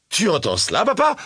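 high, rapturous question to papa; delighted cry. A narrator's reading. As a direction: exclaim in rapture, pitch soaring at the end. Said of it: "s'écria la jeune aveugle dans le ravissement". (cri ravi, de plus en plus aigu)

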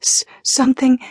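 high, stammered cry of alarm; mock-horrified cry. Ariana pretends something has caught her, crying out dramatically. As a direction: act out being seized, stuttering. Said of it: "Ariana cried".

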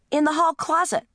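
high-pitched answering holler; daughter's shout. A narrator's reading. Daughter calling back to mother, high shout. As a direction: call back from another room. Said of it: "shouted my daughter".

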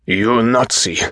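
high-pitched, outraged insult; angry accusation. A narrator's reading. Furious patient turning on the doctor, outraged. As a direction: hurl it as an accusation.

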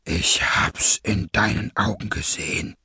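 low, breathy villain voice; cold masked villain. Acted. Masked leader accuses a traitor, low and breathy.

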